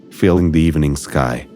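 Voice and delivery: ordinary delivery, nothing marked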